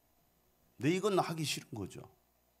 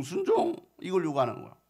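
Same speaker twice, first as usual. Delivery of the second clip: ordinary delivery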